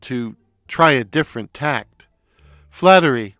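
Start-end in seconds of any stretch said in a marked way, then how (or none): none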